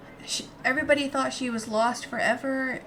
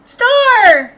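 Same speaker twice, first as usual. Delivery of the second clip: loud high call across the room; 14 semitones higher, 17 dB louder than usual. Acted. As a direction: shout the name to wake it. Calling out to creature.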